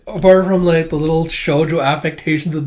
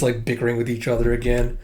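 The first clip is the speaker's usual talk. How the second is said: ordinary talk, nothing marked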